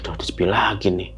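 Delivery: hushed, breathy worry to herself; worried whisper. Retold or imitated. Alone in the dark hallway, whispering worriedly. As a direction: whisper it like nobody should hear.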